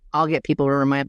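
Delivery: normal talking voice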